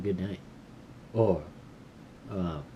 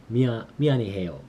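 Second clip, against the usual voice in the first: 미안해요 rattled off very fast; rapid practice; 5 dB louder, much faster than usual. Shown as a demonstration. Learner drilling an apology, very fast. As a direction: fire off 미안, 미안해요 quickly. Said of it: "making sure I say it right".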